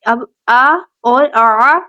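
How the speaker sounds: آ light then آ squeezed, slow; آ glottal, then آ throaty; hamza vs ain. Shown as a demonstration. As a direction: contrast the two آ sounds. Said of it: "ان میں کیا ہے".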